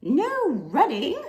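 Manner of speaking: slow, loud stern rebuke. Acted. Librarian rebukes running man, loud stern rebuke. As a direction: scold without looking up. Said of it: "said Miss Merriweather without looking up".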